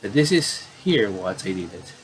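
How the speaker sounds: normal talking voice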